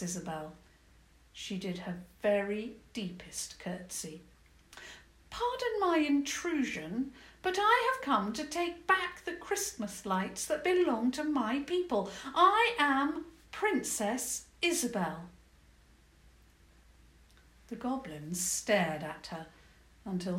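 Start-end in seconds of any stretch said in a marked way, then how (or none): 5.3-15.3 higher, dignified princess voice; formal princess. Acted. Princess addresses the goblin queen, formal high voice. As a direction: speak formally like royalty.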